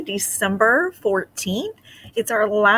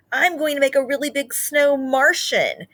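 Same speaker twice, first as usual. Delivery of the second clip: higher, keen little mouse voice; eager mouse James; 8 semitones higher than usual. Acted. James telling friends his snow Martian plan, eager. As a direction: boast happily about the plan. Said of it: "said James".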